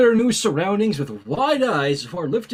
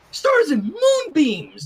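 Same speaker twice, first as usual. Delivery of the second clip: excited exclamation; 9 semitones higher, 5 dB louder, slower than usual. Acted. Twilight's exclamation read aloud.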